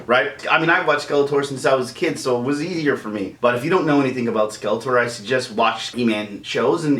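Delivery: ordinary talk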